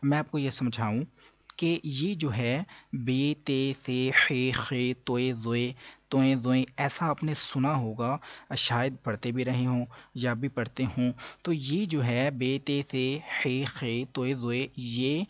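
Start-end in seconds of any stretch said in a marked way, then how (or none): 2.9-6.6 Urdu-style "بے، تے، سے" with long -e endings; Urdu pronunciation. Shown as a demonstration. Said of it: "عربی لہجہ نہیں ہے". 12.3-14.7 Urdu letter names "بے، تے، سے" ending in -e; Urdu pronunciation. Shown as a demonstration. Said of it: "عربی لہجہ نہیں ہے".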